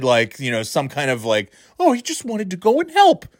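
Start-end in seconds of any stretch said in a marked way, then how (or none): 1.8-3.3 high-pitched mock sympathy; mocking media excuse. Host mocking news outlets excusing the kid, sarcastic.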